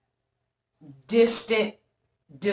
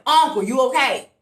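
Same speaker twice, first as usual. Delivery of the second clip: raised, higher worried call; retold yell; 6 semitones higher, 5 dB louder than usual. Yelling at Uncle stuck up on the landing, raised voice. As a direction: call out as if to someone across the landing. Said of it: "So I'm yelling at him".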